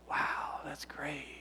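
barely voiced, smitten murmur; whispered, awestruck reply; hushed delight. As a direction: breathe it out, melted by affection. His smitten reply to wife's whisper.

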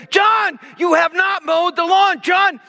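put-on over-the-top father scolding his teenage son; screaming father. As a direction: play the furious dad, high and shouting. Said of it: "I just began to scream".

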